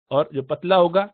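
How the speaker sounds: ordinary talk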